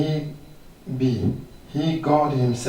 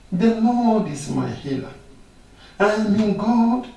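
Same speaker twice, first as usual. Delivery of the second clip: higher, louder triumphant shout; defiant preaching; 7 semitones higher, 4 dB louder than usual. Acted. Preacher defying sickness before the congregation, triumphant. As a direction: fling "Nothing" back at sickness.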